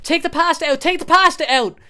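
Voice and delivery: high, loud mock panic; shrill nagging girlfriend. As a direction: squeal it like a fussing girlfriend. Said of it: "and she's like".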